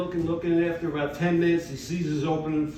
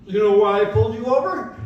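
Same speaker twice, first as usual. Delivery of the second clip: cop voice; 5 semitones higher, 7 dB louder than usual. Retold or imitated.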